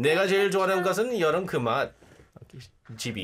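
put-on sung fast in a North Korean accent. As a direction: croon the pop tune with a Pyongyang accent. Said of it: "sing it with the rhythm in North Korean accent".

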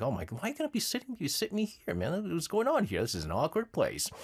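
high, rapid flustered protest; mock alarm. Host recalling being seated with commerce people, mock alarm. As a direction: blurt it out in comic dismay.

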